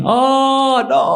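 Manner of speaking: high, long-held acted wail; mock scream of pain. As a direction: wail it high like a beaten servant. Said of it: "screaming in pain".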